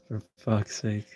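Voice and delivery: ordinary talk, nothing marked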